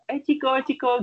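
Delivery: high-pitched coaxing pet call; calling a dog. Retold or imitated. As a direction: imitate calling your dog over. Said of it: "terus kalau tetep lu panggil kayak".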